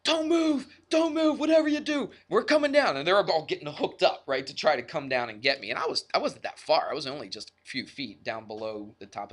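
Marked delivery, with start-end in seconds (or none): 0.0-3.0 high, urgent yell of people calling down; mock yelling. Rescuers on the hilltop shouting down to the stuck climber, high urgent yell. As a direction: shout it up high, urgent, to stop someone moving. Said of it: "they're all yelling and screaming at me".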